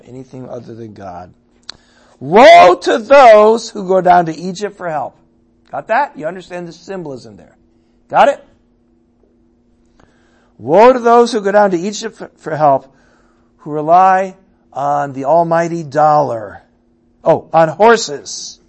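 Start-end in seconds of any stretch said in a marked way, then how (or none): 2.2-5.2 loud, high preaching of woe; proclaimed warning. Acted. 10.6-18.6 loud, high preaching of woe; proclaimed warning. Acted. Preacher repeating woe emphatically.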